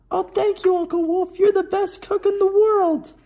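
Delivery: very high, loud chirpy chick voices; excited baby chicks. A narrator's reading. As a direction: squeal it like happy little chicks. Baby chicks thank the wolf.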